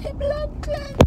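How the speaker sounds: playful high-pitched echo of blood cleanse; squeaky high repeat. Shown as a demonstration. Repeats the phrase much higher.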